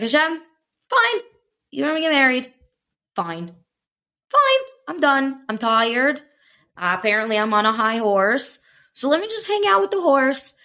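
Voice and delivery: high, exasperated retold plea to God; acted-out meltdown, pitch leaping on the outbursts; fed-up breakdown. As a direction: re-enact giving up in a high, worked-up voice. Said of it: "I was crying in my basement".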